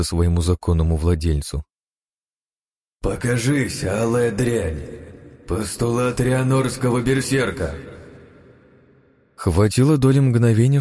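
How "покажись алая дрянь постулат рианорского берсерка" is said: high, slow battle invocation; fierce invocation. Acted. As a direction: call up the berserker power. Summoning berserker power. (яростный призыв)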